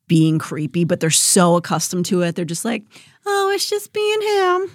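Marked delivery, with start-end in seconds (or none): 3.3-4.7 much higher, slow, airy shrug; abused partner excusing her man, slow high resigned shrug; resigned brush-off. Retold or imitated. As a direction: wave it off like it's normal.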